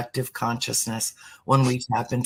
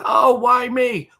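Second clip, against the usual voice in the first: much higher, louder and slow, a whiny "why me"; mock self-pity; 13 semitones higher, 7 dB louder, slower than usual. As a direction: play the pitying complainer. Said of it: "the question that most people say is".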